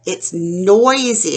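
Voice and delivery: normal talking voice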